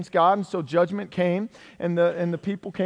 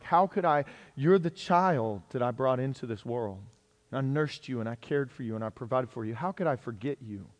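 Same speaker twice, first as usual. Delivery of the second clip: low, soft, tender reassurance; acted motherly tenderness; 4 semitones lower, 4 dB quieter than usual. God as mother to his forgotten-feeling child, soft and tender. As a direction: speak softly as to your own child.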